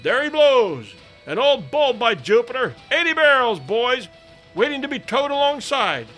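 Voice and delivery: high, loud broken shouts; cheering exclamations. A narrator's reading. Boat officer cheering his rowers after the whale, excited shouting. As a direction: urge the rowers on, burst by burst. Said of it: "broken exclamations and adjurations with which I cheered".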